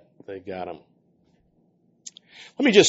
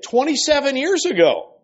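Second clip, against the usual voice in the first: high-pitched raised voice; defiant demon answer; 14 semitones higher, 5 dB louder than usual. Acted. Demon replying to the healer's question, high and forceful.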